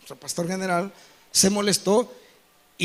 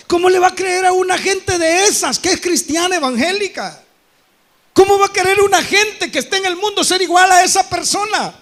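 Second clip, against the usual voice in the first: loud rhetorical rebuke; preaching; 6 semitones higher, 5 dB louder than usual. Real, not acted. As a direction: hammer the questions out.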